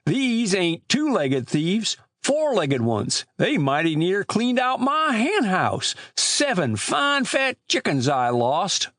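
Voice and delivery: put-on high, slow rustic old man; Amos Grice. Old storekeeper telling boys of lost chickens, high drawling voice. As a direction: drawl it like an aggrieved country storekeeper.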